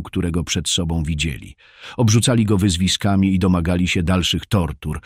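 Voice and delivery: ordinary delivery, nothing marked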